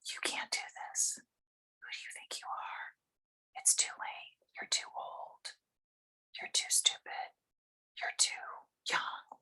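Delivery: harsh little whisper of self-doubt; critical inner voice. Acted. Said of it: "that little voice that says".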